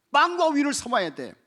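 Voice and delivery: loud, high insistence; 빵과 우유를 shouted from the pulpit; emphatic shout. Shown as a demonstration. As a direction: drive the point home. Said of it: "뭐 해야 됩니까?".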